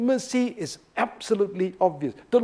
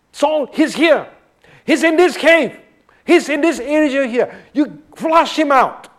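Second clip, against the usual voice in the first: raised, urgent shout of a hunter; urgent soldier call; 7 semitones higher, 6 dB louder than usual. Acted. Soldier calling to Saul at the cave, a loud urgent shout. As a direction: call out to the others that he's found.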